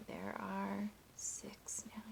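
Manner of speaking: softly whispered aside; whispered counting aside; hushed whisper. Acted. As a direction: whisper it to the class, slowly.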